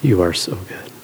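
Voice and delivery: soft, low sincere thanks; mean every word, gently; grateful tone. Shown as a demonstration. Preacher modelling the right grateful tone, sincerely. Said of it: "We want to say".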